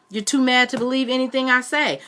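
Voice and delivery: put-on boy arguing with his aunt; defiant boy. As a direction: push back at the angry aunt.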